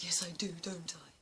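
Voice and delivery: put-on whispered to a little girl, smiling; hushed kindly reply; soft whisper. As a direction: breathe it gently with a smile.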